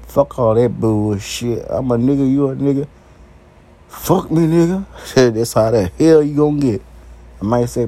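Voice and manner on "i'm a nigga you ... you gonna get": ordinary talk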